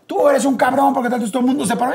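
retold angry yell. The double bursts in and accuses the host, high angry yell. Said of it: "Entraba y decía".

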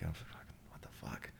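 breathless whispered swearing; shocked whisper. Retold or imitated. Retold shock on hearing it's real.

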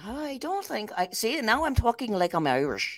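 English accent slipping into Irish; attempted English accent. Shown as a demonstration. Host trying Chris's accent on air, shaky English-Irish accent. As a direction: have a wobbly go at an English accent, drifting Irish. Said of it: "do your accent".

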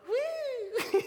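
high, weaker imitation whoop; a tourist's attempt, high but timid; tourist's try at the woo. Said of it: "the people would get a".

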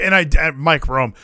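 ordinary delivery

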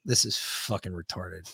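quiet aside to yourself; thinking to himself. Retold or imitated. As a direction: say it under your breath, as a private thought. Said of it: "thinking to myself".

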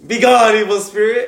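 loud, high banishing command; mock exorcist. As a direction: play-act casting out a demon, amused. Friend pretends to banish demons.

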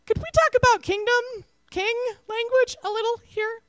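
high, tentative asking voice; hesitant question. Retold or imitated. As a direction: ask it gently, unsure. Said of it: "And they were like".

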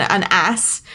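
"ass" with short A as in "cat". Shown as a demonstration. British host explaining the donkey word, short-A "ass". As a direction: say "ass" with a flat front vowel. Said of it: "that is either a donkey".

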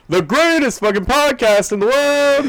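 high, loud hyped announcer; ringmaster. Acted. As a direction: bellow it like a ringmaster. Outro announcer bit.

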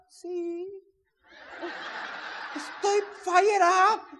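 high, slow mock assurance; mimicked wife. Husband mimicking wife saying she is excited, high slow voice. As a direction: imitate her drawn-out claim of excitement. Mimics wife's unexcited reply.

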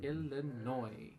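ILLINOIS stretched very slowly; drawn out. Real, not acted. Host repeating a contestant's answer, drawn out slowly. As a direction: linger on every syllable of Illinois. Savours the answer a second time.

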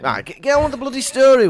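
loud, high, impatient outburst; exasperated. Real, not acted. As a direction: cut in and hurry the rambler along. Co-host interrupts a dithering storyteller.

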